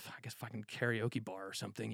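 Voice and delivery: resigned muttering while stuck in traffic. Retold or imitated. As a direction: grumble it under your breath, giving up. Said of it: "So I'm like".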